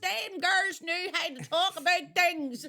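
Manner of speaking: high, loud, slow and laughing pensioner; laughing hammed-up elderly fan; mock nostalgic old woman. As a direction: gush like a nostalgic granny. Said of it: "And you're gonna go".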